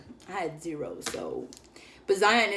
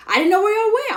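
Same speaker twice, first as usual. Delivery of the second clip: excited; 12 semitones higher, 9 dB louder, faster than usual. Retold or imitated. Friend explaining, defensive.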